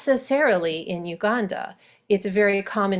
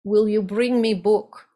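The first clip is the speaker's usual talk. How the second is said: Russian accent. Shown as a demonstration. Coach demos Russian grammar, Russian accent. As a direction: ask for the book in a Russian accent. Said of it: "And then Russian".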